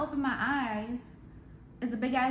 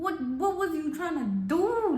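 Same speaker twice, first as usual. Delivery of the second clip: high, louder retold confrontation; indignant, raised-voice challenge; outraged demand; 8 semitones higher, 5 dB louder than usual. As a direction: demand an answer, voice pitched up.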